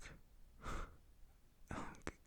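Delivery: long, whispered exhale; long sigh. Retold or imitated. Son reacting to mum's shop request, long sigh. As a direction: let out a drawn-out breathy sigh.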